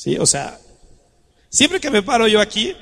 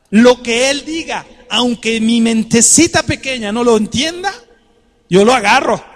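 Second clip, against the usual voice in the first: fervent preaching; 4 semitones higher, 5 dB louder than usual. Acted. Preaching at full force.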